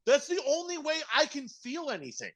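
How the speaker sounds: hammed-up self-pity; mock melodrama. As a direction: whimper it with fake pathos.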